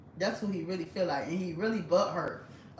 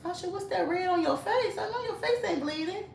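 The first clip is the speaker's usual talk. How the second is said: much higher, teasing put-on voice; sassy self voice; 10 semitones higher than usual. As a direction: tease like a mock scolding friend.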